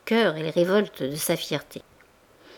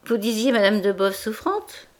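surprised question, then a louder, higher exclamation; 4 semitones higher, 5 dB louder than usual. A narrator's reading. Valagnosc spots a supposedly ailing lady, very surprised. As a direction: build from puzzled query to astonishment. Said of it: "dit Valagnosc très surpris".

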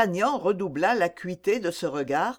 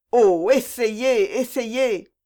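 high, drawn-out plea; slow, loud, higher-pitched entreaty; urgent begging; 3 semitones higher, 4 dB louder, much slower than usual. Acted. Young lover begging the captain to speak, urgent begging. Raoul begs captain to tell.

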